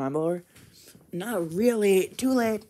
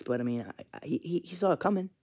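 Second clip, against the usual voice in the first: ordinary talk, nothing marked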